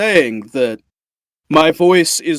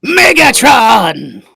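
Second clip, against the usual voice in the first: slow, loud, higher robot warlord shout; Megatron; 7 semitones higher, 3 dB louder, much slower than usual. Retold or imitated. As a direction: bellow the name like a robot tyrant. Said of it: "Frank Welker when he did Megatron".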